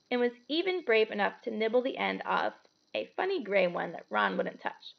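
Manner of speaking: ordinary delivery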